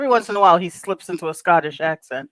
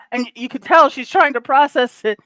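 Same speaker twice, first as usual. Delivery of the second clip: normal talking voice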